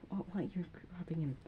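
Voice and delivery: unvoiced whispered aside. Real, not acted. Child whispers an aside during the game. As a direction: whisper it so nobody hears.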